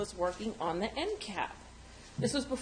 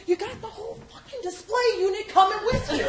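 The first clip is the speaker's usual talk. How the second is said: high, loud laughing yell; laughing shout; 12 semitones higher, 8 dB louder than usual. Retold or imitated. Boyfriend yelling that she drags the display, high laughing shout. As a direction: blurt it out in disbelief through laughter.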